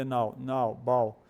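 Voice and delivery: NOW repeated, then BOW on the same "ow". Shown as a demonstration. Teacher walking learners to the sound, bow like now. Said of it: "a pronúncia dele é próxima do now".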